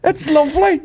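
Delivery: high, relishing list with a laughing finish; mock porridge enthusiast. Listing toppings for a doubter, high and hammed-up.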